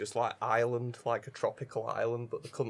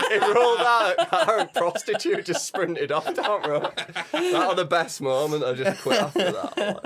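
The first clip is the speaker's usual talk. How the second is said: laughing through the whole story; can barely get it out for laughing; 6 semitones higher, 8 dB louder than usual. Real, not acted. Laughing while telling GTA story.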